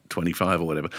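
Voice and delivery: ordinary talk, nothing marked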